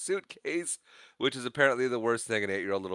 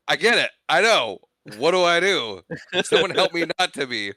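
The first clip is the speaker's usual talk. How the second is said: high, loud mock exasperation; exasperated pleading; 7 semitones higher, 7 dB louder than usual. Little Kevin answering his mocking siblings, exasperated pleading. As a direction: imitate a kid pleading loudly for help.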